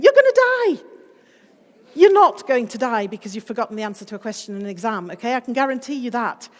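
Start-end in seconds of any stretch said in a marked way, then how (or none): 0.0-0.8 much higher, louder alarm; mock panic. Amygdala yelling at exam students, hammed-up panic. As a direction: squeal it like a panicking brain. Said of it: "your amygdala is saying".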